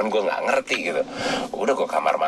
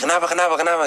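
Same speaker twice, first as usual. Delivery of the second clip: imitating a worried father, higher pitch; quick repeated questions in lightly acted alarm; mock alarmed; 10 semitones higher, much faster than usual. Father rushing to the bathroom at night, imitated alarm.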